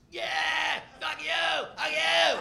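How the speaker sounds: high, loud heckler yells; yelling. Retold or imitated. Punk crowd heckling the opening comic, acted-out yelling. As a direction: imitate a hostile crowd at full shout. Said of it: "just yelling".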